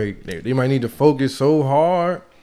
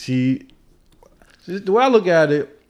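chewing while talking; 4 semitones higher than usual. Real, not acted. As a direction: mouth full of food. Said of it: "I'm sorry I'm chewing".

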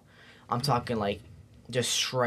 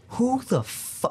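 angry outburst; 12 semitones higher, much slower than usual. Retold or imitated. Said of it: "I got pissed off".

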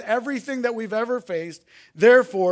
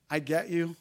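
gentle invitation; 6 semitones lower, 10 dB quieter than usual. Acted. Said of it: "then he says this".

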